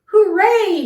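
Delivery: high, loud cheer; big exultant holler; jubilant shout. A narrator's reading. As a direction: shout it in triumph. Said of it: "shouted Yertle".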